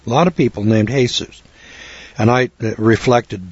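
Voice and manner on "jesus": Jesus said as "hay-soos"; Spanish pronunciation. Shown as a demonstration. People named so in Mexico, Jesus as "hay-soos". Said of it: "but they pronounce it".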